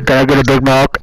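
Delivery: drive-thru customer. Pretend. Pretend drive-thru order.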